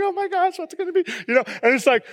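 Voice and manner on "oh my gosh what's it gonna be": high, softer, imitating the teary wife on the show; mock tearful gush. Pastor mimicking the teary wife on the show, a mock gush. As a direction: squeal it like a teary TV homeowner. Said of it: "the girl's always crying".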